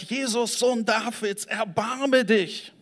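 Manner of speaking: high pleading call, the last words drawn out slowly; crying out for mercy. Retold or imitated. Blind beggar calling out to passing Jesus, high pleading cry. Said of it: "fing er an zu schreien".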